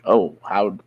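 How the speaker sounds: usual speaking voice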